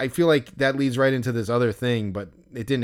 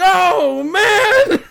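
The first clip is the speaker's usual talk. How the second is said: much higher, loud groan of disappointment with a laugh; loud dismay; 9 dB louder than usual. Retold or imitated. Fan learning he missed the player, loud wailing dismay. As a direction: wail it like the chance is gone.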